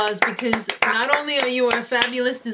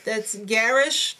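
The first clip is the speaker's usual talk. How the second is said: ordinary delivery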